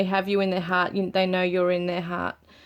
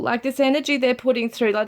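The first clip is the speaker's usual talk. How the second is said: usual speaking voice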